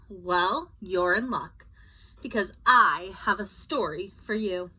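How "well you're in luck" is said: usual speaking voice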